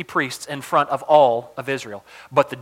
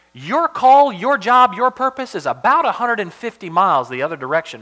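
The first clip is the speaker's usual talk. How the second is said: high, loud opening that gradually eases; emphatic rebuke; 6 semitones higher, 5 dB louder than usual. Acted. God redirecting his wayward prophet, emphatic rebuke. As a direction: drive home each "your" forcefully. Preacher voicing God's rebuke to Elijah.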